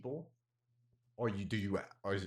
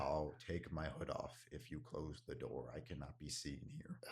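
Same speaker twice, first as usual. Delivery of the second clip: masculine voice; 6 semitones lower, 10 dB quieter than usual. Acted. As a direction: hush it so no one overhears.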